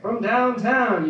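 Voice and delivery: slow, drawn-out announcer call; sports announcer. Retold or imitated. Comic quoting video game announcers, slow hype call. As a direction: stretch it like a three-pointer call. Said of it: "instead of being like".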